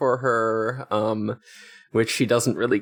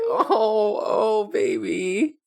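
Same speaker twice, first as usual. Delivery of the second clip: cooing; 9 semitones higher, much slower than usual. Real, not acted. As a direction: coo over a tiny kitten. Cooing over sad tiny kitten.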